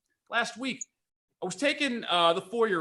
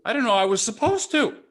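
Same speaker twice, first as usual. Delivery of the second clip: mother's innocent reply; 6 semitones higher, 4 dB louder, faster than usual. Retold or imitated. Mother voiced in story.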